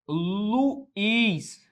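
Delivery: Luiz drawn out as Lu-iz; hiatus demo. Shown as a demonstration. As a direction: stretch Luiz into two syllables. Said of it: "fica assim, ó, na separação".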